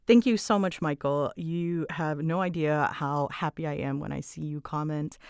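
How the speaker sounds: normal talking voice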